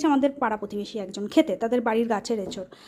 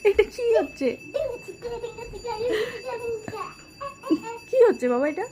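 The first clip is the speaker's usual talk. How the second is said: amused teasing; 8 semitones higher than usual. Real, not acted. Mother teasing her dancing little son, playfully. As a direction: tease the little dancer, pitch up.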